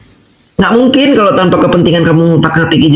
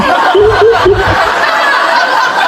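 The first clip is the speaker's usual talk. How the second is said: high gloating cackle; gloating laugh; 13 semitones higher than usual. Real, not acted. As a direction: cackle high at someone else's downfall. Gloats at fear of handcuffs. (tawa puas mengejek)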